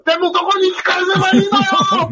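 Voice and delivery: high, fast bossy daughter voice. Retold or imitated. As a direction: scold grandpa high and quick. Daughter takes charge, scolds.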